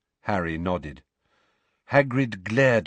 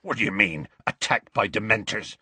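usual speaking voice

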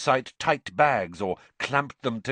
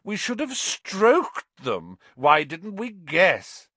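high, drawn-out mock regret; slow, scornful sarcasm; sneering; 8 semitones higher, 5 dB louder, slower than usual. A narrator's reading. Malfoy mocking Hagrid's advice, sneering. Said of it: "Malfoy sneered".

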